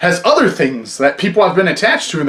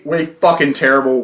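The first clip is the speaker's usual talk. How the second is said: ordinary talk, nothing marked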